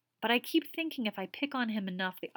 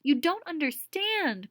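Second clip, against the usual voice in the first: louder, slow anguished cry; 8 semitones higher, 6 dB louder, slower than usual. Acted. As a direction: cry it out in frustration. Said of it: "cried Missus Wessex".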